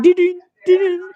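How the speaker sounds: ping it out like a phone notification; imitated phone alert. Retelling the phone suddenly going off, high imitated chimes. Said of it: "my phone's like".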